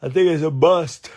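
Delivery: high, loud excited outburst; emphatic exclamation. Real, not acted. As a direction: blurt it out loudly, pitched high. Exclaims, then repeats it normally.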